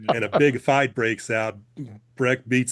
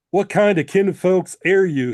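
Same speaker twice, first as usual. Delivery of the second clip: a loud, high, scowling demand; read-aloud scowl, pitched up and loud; 8 semitones higher, 8 dB louder than usual. A narrator's reading. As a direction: throw the question at your kin like a challenge. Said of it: "he scowled".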